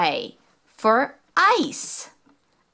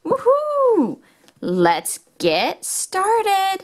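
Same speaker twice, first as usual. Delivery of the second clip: put-on high, fast, bubbly kid-show cheer; excited; 12 semitones higher than usual. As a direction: hype up the little ones. Excited kids' host hype.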